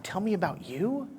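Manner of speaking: breathy whispered quote. Retold or imitated. Coach whispering the worst pitch question to the audience. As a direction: whisper it like a dirty secret.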